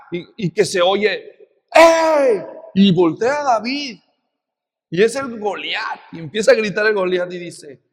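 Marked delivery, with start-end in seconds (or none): 1.7-2.4 higher, loud challenge call; booming shout. Retold or imitated. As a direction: bellow across the battlefield. Goliath calling out.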